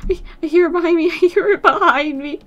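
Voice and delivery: half scared, half giggling; frantic scared giggle; panicked laughing. Real, not acted. Zombie chasing her.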